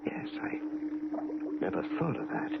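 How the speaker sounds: breathless, barely voiced wonder; almost whisper it, spellbound; the visitor, won over, hushes in awe; awed hush.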